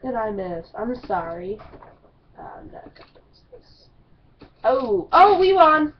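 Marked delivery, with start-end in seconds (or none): 2.4-3.9 whisper. Real, not acted. Embarrassed aside after missing. 4.6-5.9 excited. Real, not acted. Sudden surprise win.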